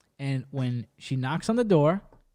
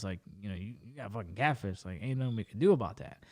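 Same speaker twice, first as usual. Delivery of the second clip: much lower, softer, offhand officer voice; bored police officer; 9 semitones lower, 9 dB quieter than usual. Retold or imitated. Police telling a catfish victim nothing can be done, low and offhand. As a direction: shrug it off like an unbothered cop.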